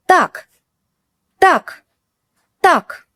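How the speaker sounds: Так snapped high and sharp, like ending an argument; sharp stern tak. Shown as a demonstration. Teacher showing learners a sharp stop-it Так. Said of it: "It can mean, «Enough, stop.»".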